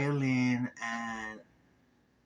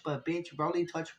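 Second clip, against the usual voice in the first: ordinary delivery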